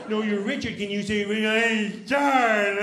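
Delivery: slow, high, loud teacher voice with a laugh; teacher talking down to a kid; teacher correcting. Retold or imitated. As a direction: correct the child patiently.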